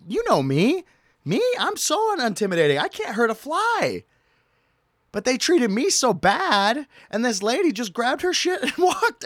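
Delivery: high, squeaky mock-innocent voice; mock innocence. Real, not acted. Telling viewers, high mock-innocent protest. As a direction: play yourself as harmless.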